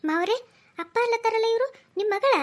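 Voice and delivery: loud, high disbelief; astonished question. Acted. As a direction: blurt it out, stunned by the family secret. In-law astonished by secret daughter.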